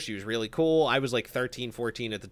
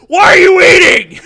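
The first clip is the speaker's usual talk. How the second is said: very loud, much higher yell; dad shout; 21 dB louder than usual. Retold or imitated. Dad yelling at his son for eating, furious shout. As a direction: bellow it at the kid. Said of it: "Walks away".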